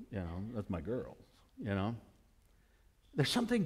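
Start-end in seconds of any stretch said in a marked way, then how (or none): 0.1-1.9 soft, low, fond murmur; much softer tender take. Retold or imitated. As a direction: say it quietly, full of affection. Tender aside about his daughter.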